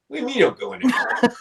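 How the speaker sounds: laughing disbelief. Retold or imitated. To the lady who won't enter, a laughing high incredulous question. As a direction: retell the shock through a laugh. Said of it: "I'm like".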